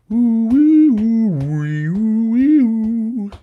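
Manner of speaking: high wailing ooh-wee; silly waiting noises. Pretend. Host waits for listeners to answer, silly waiting noises. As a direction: swoop up high like a toy siren.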